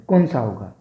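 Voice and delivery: usual speaking voice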